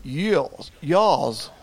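"Yaws" for "years", R dropped and vowel opened; mock English vowel in "yaws", no R. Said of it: "why do the English say".

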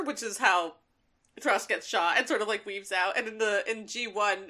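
usual speaking voice

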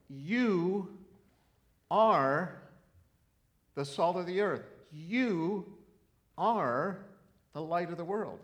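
"You" and "are" each hit high and loud, slow and separated; preached emphasis on "You are"; emphatic YOU ARE. Shown as a demonstration. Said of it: "I tried to read it this way".

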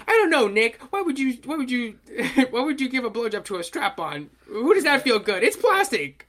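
high, loud mock disbelief; cartoon character voice. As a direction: squeal it like an outraged cartoon teen. Voicing cartoon character's outraged line.

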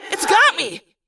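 high, panicked cry; terrified as she's caught; scream. A narrator's reading. Cassie caught by a vine, panicked scream. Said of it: "Cassie's ThoughtSpeak voice just screamed".

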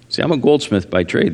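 ordinary delivery

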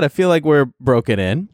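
ordinary talk, nothing marked

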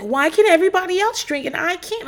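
a high whiny imitation of a sulking drinker; high whiny mock complaint. Imitating Brandi on the show, high and whiny. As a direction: pitch it way up, mock-indignant. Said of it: "she'd just be like".

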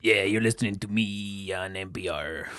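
soft, low, slow public-radio voice; hushed radio-host send-up; mock NPR host. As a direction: lull the listeners quietly, slowly. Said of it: "That's not an N P R voice".

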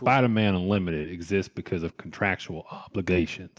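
put-on Southern accent. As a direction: read it out in a mock Southern accent. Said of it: "do it in a Southern accent".

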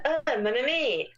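mock a stage Frenchman; fake French accent. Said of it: "fake French accent".

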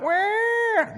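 high mock newborn wail; a short, hammed-up baby cry. Imitating a newborn's movie cry.

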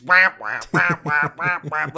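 loud imitated footstep whaps; big stomping steps. Co-host imitating Haruka's huge steps, loud whaps. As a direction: bark out each giant step as a comic noise. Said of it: "she just big step-ins out".

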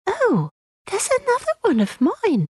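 put-on high, piping little girl; child's voice. Lucy finding another of her things, high child's voice.

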